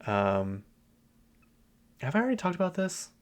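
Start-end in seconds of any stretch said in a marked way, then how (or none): 2.0-3.1 high, fast startled question; suddenly unsure, pitch jumping up; surprised realisation. Real, not acted. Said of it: "Sorry, I'm recording on a different day".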